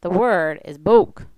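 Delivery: "bok" loud and drawn out with a rounded, hollow vowel; slowly and loudly model "bok" with rounded mouth; corrective Swedish pronunciation. Retold or imitated. Host brother correcting the speaker, emphatic Swedish "bok". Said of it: "my host brother said".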